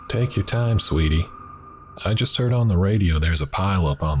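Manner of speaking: a lightly voiced Southern waitress gossiping; delicate southern accent. Southern waitress gossips to customer, light Southern lilt. Waitress chats about pileup.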